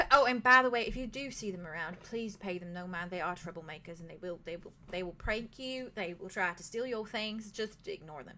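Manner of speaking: quiet, motherly innkeeper voice; soft-spoken innkeeper. Acted. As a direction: speak low and confiding, like a fond mother warning guests. GM voices Ed Leaf at length.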